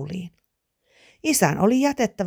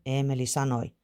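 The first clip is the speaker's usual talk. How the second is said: usual speaking voice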